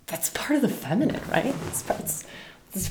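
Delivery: hushed aside under the breath; soft secret whisper. Real, not acted. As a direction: lean in and whisper it.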